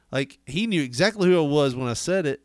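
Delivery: usual speaking voice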